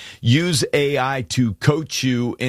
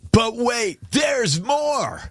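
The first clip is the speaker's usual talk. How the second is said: high-pitched hammed-up TV pitch; mock salesman's big reveal; mock infomercial pitchman; 9 semitones higher, much slower than usual. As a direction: playfully crow it like a late-night infomercial, high and drawn out. Parody of infomercial catchphrase.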